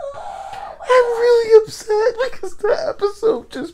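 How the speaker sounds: high, squeaky talk through laughter; helpless with laughter while complaining; laughing hard. Real, not acted. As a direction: struggle to speak through real laughter. Cracking up over an episode.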